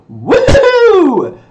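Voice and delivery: high, loud, held "woo"; hype whoop. Real, not acted. Streamer opens the gameplay stream, whooping loudly. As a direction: whoop long and high to fire up viewers.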